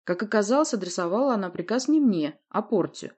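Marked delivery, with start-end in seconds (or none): none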